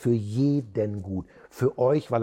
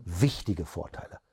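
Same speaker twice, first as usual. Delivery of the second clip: WICHTIGE set apart, lower voice; stress on WICHTIGE; 6 semitones lower than usual. Shown as a demonstration. Trainer demonstrates marking key words, stress on WICHTIGE. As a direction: lean firmly on WICHTIGE. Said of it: "bitte Wichtiges betonen".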